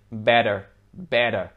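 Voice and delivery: American better, T's like a D; American accent. Shown as a demonstration. Teacher contrasting with British English, American accent. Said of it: "the two T's sound more like a D".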